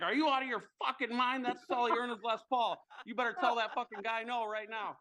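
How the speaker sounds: high-pitched imitation of a scolding mother; outraged mom. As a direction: act out mom laying down the law. Mother's outraged refusal, acted out.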